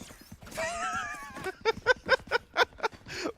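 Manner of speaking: high shrieking laugh. Real, not acted. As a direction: burst out laughing. Co-caster bursts out laughing.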